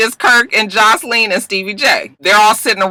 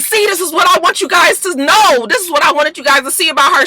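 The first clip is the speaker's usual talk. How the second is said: high, insistent retold plea; earnest plea; 8 semitones higher than usual. Tammy pleading for her friend to the group, earnest. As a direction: push it out high and urgent.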